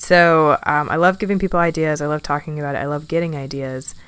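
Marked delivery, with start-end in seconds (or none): none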